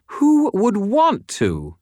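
slow, high scornful woman's voice; scornful retort. A narrator's reading. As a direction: throw it back high and drawn out. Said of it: "she retorted".

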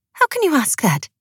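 high-voiced wife protesting; exclaimed protest. A narrator's reading. Wife challenging her husband's question, exclaimed in a high voice. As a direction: exclaim it high, taken aback. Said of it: "exclaimed Ilva".

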